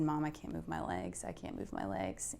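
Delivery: a quiet, broken retelling of the plea; retold crying plea. Telling how she begged her mom, a soft, sinking plea. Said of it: "I just cried and said".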